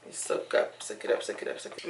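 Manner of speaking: soft whispered sing-song; whispering. Real, not acted. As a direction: breathe the repeats in a whisper. Whispered chant.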